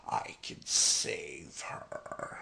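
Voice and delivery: hushed, slow, breathy whisper. Retold or imitated. Stranger whispering to the grieving father. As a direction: whisper the offer like a secret. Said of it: "dude comes up behind him and is like".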